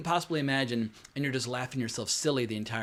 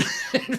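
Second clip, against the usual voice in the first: short high laugh; brief laugh after his own joke; quick burst of real amusement; amused laugh; 12 semitones higher than usual. Real, not acted.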